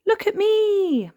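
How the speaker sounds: put-on very high, drawn-out child's call; loud sing-out for attention; showing off. A narrator's reading. Child showing off her costume, calling out high.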